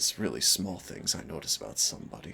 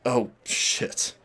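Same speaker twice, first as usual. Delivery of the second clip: sudden high, loud, breathy oh shit; startled curse; 7 semitones higher, 6 dB louder than usual. Real, not acted. Realizing she is late, a startled high curse. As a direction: caught off guard by the time.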